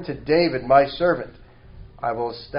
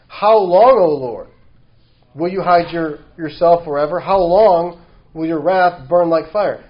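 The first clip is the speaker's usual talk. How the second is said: impassioned lament; 3 semitones higher, 7 dB louder than usual. Acted. Psalmist crying to God through the preacher, loud. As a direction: cry out to God in protest.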